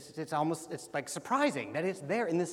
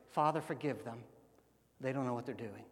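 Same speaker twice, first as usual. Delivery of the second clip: tender quote; 5 semitones lower, 5 dB quieter, faster than usual. Acted. Quoting Jesus softly after loud list.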